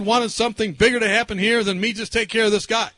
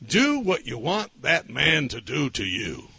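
slow, lower-pitched pronouncement; voice of the Lord; 7 semitones lower, 3 dB quieter, slower than usual. Retold or imitated. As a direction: lay down each word as divine instruction. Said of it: "the Lord impressed me".

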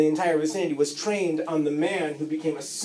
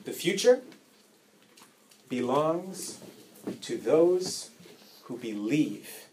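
closing sermon; 4 semitones lower, 5 dB quieter than usual. Acted.

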